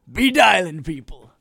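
loud call out. Acted. Urging callers to phone in.

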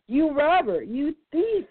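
high, sharp accusing yell; hammed-up heron shriek; harsh scream. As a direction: shriek the insult at the hawk. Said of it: "he screamed harshly".

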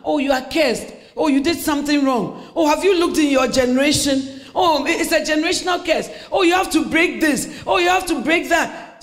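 mock self-righteous chorus; mocking judgmental Christians. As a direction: parody the gossiping church folk.

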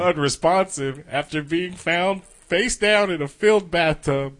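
stifled laugh reading news; reading while suppressing a laugh; holding back laughter. Real, not acted. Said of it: "you trying not to laugh while I read that".

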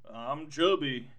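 slow, deliberate mock brag; slow self-satisfied bear; slow smug boast. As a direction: savor each word like a sly bear. Al boasts after escaping.

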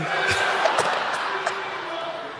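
airy laugh after the punchline; breathy laugh. Real, not acted. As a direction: let out a laugh at the joke. Laughing at her own story.

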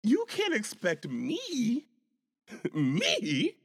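high, drawn-out incredulous rapper impression. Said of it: "in my [name] voice".

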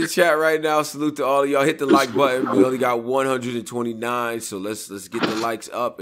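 high, loud stream shoutout; livestream host hype. Real, not acted. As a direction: pump up the chat. Host hyping the live chat.

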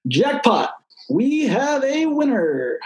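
high, drawn-out game show announcer. A narrator's reading. Boy mimics a game show host to himself, drawn-out announcer voice. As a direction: stretch it out like an announcer revealing the prize. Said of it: "his voice mimicking the announcer".

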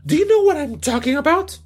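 high-voiced Pamela asking if he understands; Pamela's voice. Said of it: "Viene otra vez Pamela, dice".

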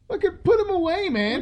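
high exasperated outburst. Pretend. Yelling at stranger jokingly.

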